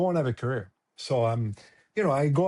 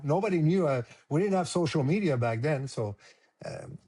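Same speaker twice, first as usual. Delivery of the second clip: normal talking voice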